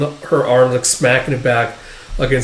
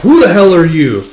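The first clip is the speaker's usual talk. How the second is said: loud indignant demand, higher on "who"; indignant challenge; 4 semitones higher, 7 dB louder than usual. Acted. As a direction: act out a thug's challenge. Thug challenged by strangers.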